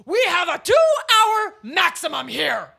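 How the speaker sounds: hammed-up high shout; loud announcement. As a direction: bellow the rule to every guest. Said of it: "She explains loudly to all of the guests".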